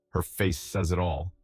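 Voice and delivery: ordinary delivery, nothing marked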